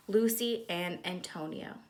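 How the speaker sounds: usual speaking voice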